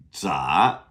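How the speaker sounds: 杂 rising, starting on a DZ sound; second tone. Shown as a demonstration. Teacher saying repeat after me, rising second tone. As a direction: say 杂 with a buzzed DZ and a rising pitch. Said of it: "Z A second tone".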